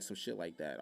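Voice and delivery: ordinary delivery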